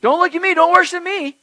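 high, loud, urgent refusal; emphatic angel deflecting attention; angel's protest. Acted. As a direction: wave off worship in alarm. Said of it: "So the angel goes on to point".

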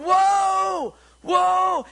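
very high, loud, long-held whoa; panicked shout. Acted. Rider heading for a cliff, panicked shout. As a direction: yell in acted panic at a runaway horse. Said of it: "He starts panicking. He starts going".

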